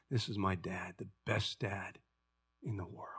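proud tender boast. Acted. Son introducing his forgiving dad, softly proud. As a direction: say it softly, glowing with pride.

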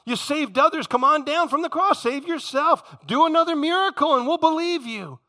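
high, insistent jeering demand; taunting challenge. Acted. Crowd daring Jesus to save himself, taunting. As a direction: goad him loudly, pitch climbing.

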